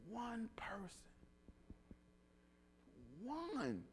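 softly whispered "One person". Real, not acted. Preacher whispering to the congregation. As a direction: hush it, almost unvoiced. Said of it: "preacher's quiet charge".

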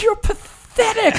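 high, laughing mock scorn from the wife; teasing wife. Wife mocking him for crying, high laughing put-down. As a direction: play the wife, amused and scolding. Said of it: "And she goes".

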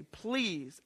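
ordinary talk, nothing marked

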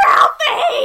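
high, very loud shrieked name; mock shriek. Mother hears what her son said, mock shriek. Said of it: "she sh-, shrieks".

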